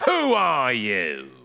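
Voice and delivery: slow, drawn-out nasal demand; nasal bark. Acted. Nosy neighbour confronts boy at door, nasal bark. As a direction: bark it through the nose, stretched out. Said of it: "he barked nasally".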